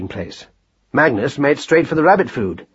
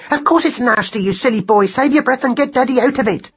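high, exasperated mother's rebuke; impatient, high-pitched ordering; urgent scolding; 11 semitones higher than usual. Acted. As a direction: scold your silly son in a high voice. Mother scolds son in emergency.

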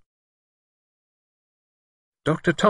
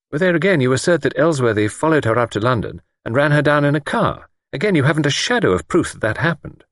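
higher, measured, a hint of a superior doctor; lightly voiced doctor; 8 semitones higher than usual. A narrator's reading. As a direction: play the calm, sceptical doctor lightly. Narrator voices Doctor Thomas.

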